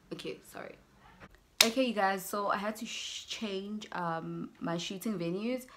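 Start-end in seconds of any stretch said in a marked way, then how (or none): 0.1-0.8 breathless unvoiced whisper, done with him; whispered dismissal. Answering the boyfriend's excuses, whispered weary dismissal. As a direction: barely give it breath, utterly unimpressed.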